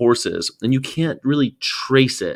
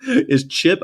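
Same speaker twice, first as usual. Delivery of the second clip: ordinary talk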